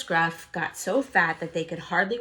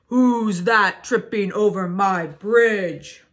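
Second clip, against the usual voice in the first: slow, loud troll roar; 9 dB louder, slower than usual. Acted. Troll roaring at the goat on his bridge, slow and loud. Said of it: "roared the troll".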